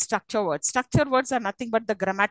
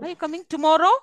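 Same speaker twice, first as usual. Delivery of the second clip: "tomorrow" hit louder, rising to the end; higher question, weight on "tomorrow"; stress on tomorrow; 5 semitones higher, 4 dB louder, faster than usual. Shown as a demonstration. Curious whether that person is coming, stress on "tomorrow". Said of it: "which word you'll be stressing".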